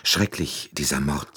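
usual speaking voice

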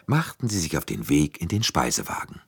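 usual speaking voice

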